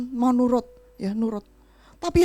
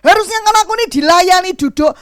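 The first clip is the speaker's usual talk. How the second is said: higher, louder indignant complaint; annoyed husband; 5 semitones higher, 7 dB louder than usual. Retold or imitated. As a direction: complain like an offended head of house. Suami kesal disuruh. (suami yang jengkel, lebih tinggi dan keras)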